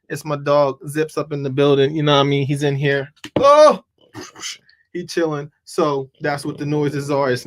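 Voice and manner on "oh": sharp loud high oh; startled yelp; sudden oh. Real, not acted. Sudden exclamation about dog.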